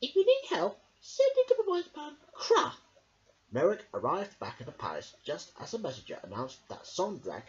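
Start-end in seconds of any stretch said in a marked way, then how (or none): 0.0-2.7 put-on high, loud crackly old woman voice; a wise old sister musing; old Myrtle. A narrator's reading. Myrtle talking to her brother, high and crackly.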